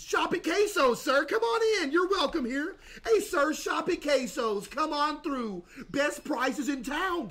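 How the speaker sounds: over-friendly store clerk; cheery shopkeeper voice. Acted. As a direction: greet the customer like an eager cashier. Calls customers into the store.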